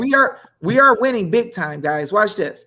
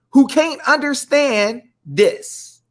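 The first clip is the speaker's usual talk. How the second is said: ordinary talk, nothing marked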